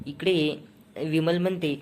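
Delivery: ordinary delivery, nothing marked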